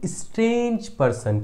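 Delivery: स्ट्रेंज पर्सन with the vowel of स्ट्रेंज held long; slow teaching delivery of स्ट्रेंज पर्सन; drawn-out answer. Shown as a demonstration. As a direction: stretch स्ट्रेंज slowly, then say पर्सन.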